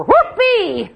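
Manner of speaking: sarcastic fake celebration; sarcastic whoopee. Real, not acted. Mocking the costly alarm bed with a sarcastic cheer.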